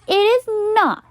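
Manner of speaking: indignant. A narrator's reading. Carla answering Buster's joke, indignant. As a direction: snap back.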